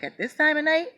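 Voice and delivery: surprised question about the hour. Retold or imitated. Jose surprised at late call.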